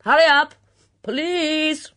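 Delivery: high, very loud holler; bellowing. A narrator's reading. Summoning her friend to the police, loud bellow. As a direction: bellow an urgent summons across the orchard. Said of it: "bellowed Miss Hinchcliffe".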